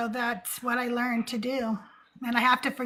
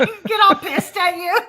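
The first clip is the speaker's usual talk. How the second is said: speaking through real laughter, higher and louder; laughing; 8 semitones higher, 6 dB louder than usual. Real, not acted. Host laughing at the guest's Heston joke, real laughter. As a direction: laugh while you get the words out.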